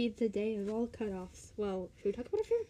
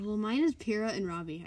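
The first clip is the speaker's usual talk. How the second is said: ordinary talk, nothing marked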